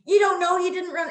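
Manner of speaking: loud, high mock retort; in-your-face cop. Officer cutting off the driver's denial, mock angry shout. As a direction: imitate an officer getting right in someone's face. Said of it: "very in my face".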